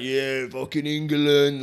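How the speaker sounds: slow, drawn-out mock patriotic cheer; mock nationalist fan. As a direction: imitate a proud England fan, dragging the words out. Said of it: "That mad nationalistic pride".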